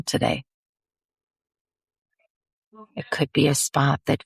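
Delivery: slow, lingering meditation voice with drawn-out words; guided meditation. Acted. Host guiding listeners to settle into the body, slow meditation. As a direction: ease them slowly inward, pausing between phrases.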